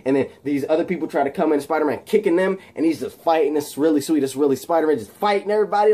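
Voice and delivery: ordinary delivery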